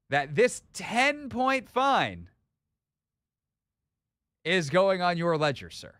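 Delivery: slow, drawn-out mock sentencing; mock verdict. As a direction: pronounce the fine like a judge. Host mock-sentencing his producer.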